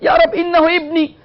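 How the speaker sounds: high-pitched cry to God; anguished plea. Acted. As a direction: act out a father pleading for a son. Noah's plea repeated.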